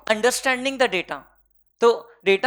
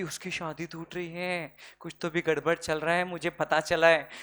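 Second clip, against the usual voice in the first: mock gossip; 4 semitones lower, 6 dB quieter than usual. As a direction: drop the voice to pass on a secret scandal. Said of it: "है ना, कि".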